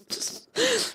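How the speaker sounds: high, soft giggle at a comment. Real, not acted. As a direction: giggle helplessly. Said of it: "I'm still laughing over Shannon's comment".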